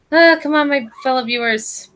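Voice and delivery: high, loud exasperated groan to the viewers; exasperated plea. Real, not acted. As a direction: come on, beg them to stay with you. Pleading with stalled stream.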